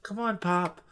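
put-on high, softened girlish voice; put-on tender daughter voice; coaxing daughter. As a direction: coax gently in a high register. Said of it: "he could hear Trisha coaxing her father".

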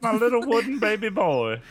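put-on high, loud baby talk; cooing. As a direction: coo like to a baby. Coos over the dummy.

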